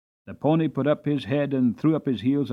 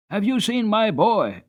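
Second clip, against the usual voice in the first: anxious; 7 semitones higher than usual. Acted. Anxious farmer.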